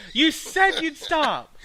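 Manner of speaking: slow, loud, high and fed up; exasperated. Real, not acted. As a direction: drag it out in frustration. Friend keeps saying sounding.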